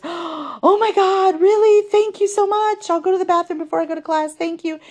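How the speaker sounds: high, gushing, breathless thanks; grateful girl. Retold or imitated. Girl thanking her for the blunt advice, high and gushing. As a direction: gush it like a relieved teenager.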